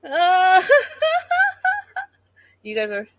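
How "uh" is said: high squealing laugh that cracks up; real burst of laughter. Real, not acted. Vlogger cracking up at her live chat, high laughing.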